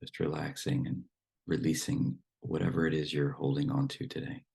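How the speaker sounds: unhurried meditation guidance with long silences; guided meditation. Acted. Teacher settles the group into relaxed breathing, guided meditation voice. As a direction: ease them into stillness, phrase by phrase.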